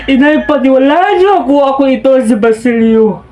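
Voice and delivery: loud, anguished son pleading; anguished Basilio. Acted. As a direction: plead with your mother at full voice. Basilio recounts beating, begs mother. (malakas at nagdadalamhating pagsusumamo)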